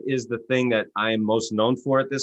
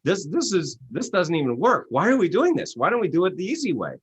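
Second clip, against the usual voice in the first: excited realization; 7 semitones higher than usual. Retold or imitated. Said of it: "I said".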